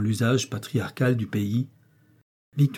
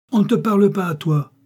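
much higher, louder furious snap; angry snap; 9 semitones higher, 6 dB louder than usual. A narrator's reading. Furious wife snapping at her husband, much higher and louder. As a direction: cut her husband off in rage. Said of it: "La colère de madame Bocken".

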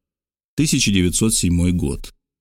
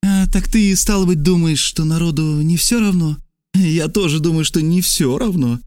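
high-pitched character voice for Trasul; Trasul in dialogue; 7 semitones higher than usual. Acted. Trasul sounds out a stranger, in a high character voice. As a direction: play Trasul higher than the narration.

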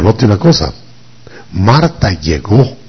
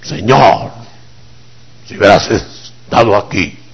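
pastor voice; 3 semitones higher than usual. Acted. Martha speaks to the Lord's face, pastor voice. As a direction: intone it like a pastor in the pulpit. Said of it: "hasta con voz de pastor se lo dijo".

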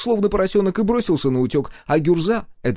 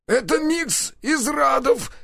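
very high shriek; tortured captive shrieking the answer; slower than usual. Acted. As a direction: squeal out the answer in pain. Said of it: "визжащим голосом ответил Каша".

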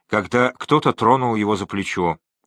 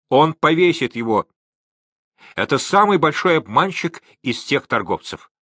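mild, higher-pitched cry; crowd shout; 5 semitones higher than usual. A narrator's reading. Crowd of Gilyaks accusing the trader, mild shout. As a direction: raise the pitch as an excited onlooker. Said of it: "закричали столпившиеся гелики".

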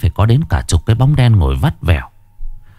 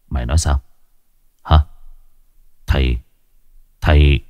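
low, stunned disbelief; an old man caught off guard; shocked old master; 6 semitones lower, faster than usual. A narrator's reading. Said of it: "Lão trợn mắt". (giọng lão sững sờ, trầm xuống)

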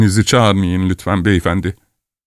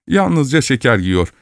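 usual speaking voice

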